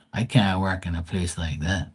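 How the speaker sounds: ordinary talk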